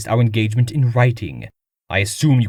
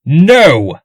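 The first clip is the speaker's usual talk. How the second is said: forceful, raised "No!"; loud denial; 4 semitones higher, 11 dB louder than usual. Acted. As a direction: reject the charge outright. Suspect denies murder.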